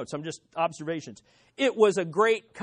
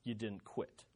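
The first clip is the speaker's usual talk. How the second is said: barely audible; 11 semitones lower, 17 dB quieter than usual. Real, not acted. Last repetition fades nearly silent.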